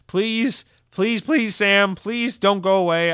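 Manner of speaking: high, loud, slow pleading; hammed-up begging to a listener; please please, a mock-desperate plea. Begging Sam to keep commenting.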